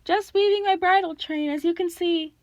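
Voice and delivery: high mock-feminine falsetto. A narrator's reading. Grover poses as the monster's bride, falsetto. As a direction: put on a falsetto, pitched well up. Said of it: "Grover said in his falsetto voice".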